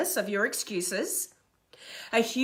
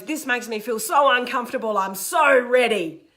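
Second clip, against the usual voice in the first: mock eagerness; 5 dB louder than usual. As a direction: pretend you are thrilled, stretching the last words. Mocking someone eager to be uncomfortable.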